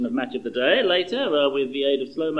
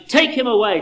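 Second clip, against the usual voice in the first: higher, louder mob cry on "Take"; crowd shouting; 8 semitones higher, 5 dB louder than usual. Acted. Angry crowd shouting at Pilate, loud mob cry. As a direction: hurl "Take him away" at Pilate. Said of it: "But they shouted".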